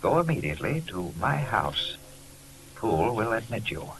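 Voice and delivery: letter read aloud. Acted. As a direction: read it like a desperate plea from a friend.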